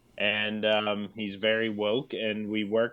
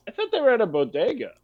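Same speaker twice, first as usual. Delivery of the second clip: lightly voiced confused viewer, higher and quick; mock bewilderment, pitched up; puzzled viewer; 11 semitones higher, faster than usual. Imagined viewer reacting to the sudden switch, mildly puzzled. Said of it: "people tuning in go".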